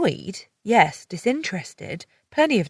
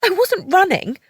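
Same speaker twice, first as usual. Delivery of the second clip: higher, louder haughty denial; haughty lie; 12 semitones higher, 7 dB louder than usual. A narrator's reading. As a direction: lie with her nose in the air. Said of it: "I lied haughtily".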